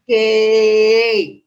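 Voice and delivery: "hey" held on one long, level sound; stuttered prolongation demo. Shown as a demonstration. Teacher showing a stutter symptom, "hey" stretched out long. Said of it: "hold a single sound for a long time".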